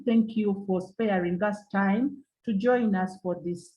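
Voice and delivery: normal talking voice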